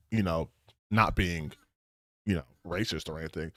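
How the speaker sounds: half-swallowed disclaimer; quick quiet aside. Real, not acted. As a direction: drop low and rush it like a sheepish aside. Sheepish aside while searching.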